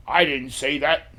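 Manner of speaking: slow, puffed-chest mock denial; indignant. Gambler denies his bet to the stick lady, mildly indignant. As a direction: imitate an indignant gambler, drawing out each word. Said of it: "Very indignant, puffing his chest out".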